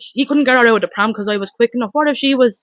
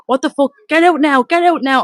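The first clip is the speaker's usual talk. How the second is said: higher, louder frantic order to get out; retold yell; 5 semitones higher, 4 dB louder than usual. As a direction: bark him away in panic. Ordering the man to leave.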